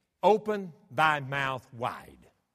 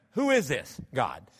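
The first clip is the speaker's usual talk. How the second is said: normal talking voice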